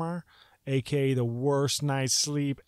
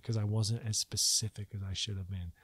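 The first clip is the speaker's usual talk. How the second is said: ordinary talk, nothing marked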